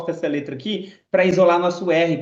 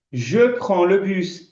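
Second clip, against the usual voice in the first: slow, careful "Je prends le bus"; slow French demo; 3 semitones higher, much slower than usual. Shown as a demonstration. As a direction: lay out every sound for learners. (frase francesa bem lenta)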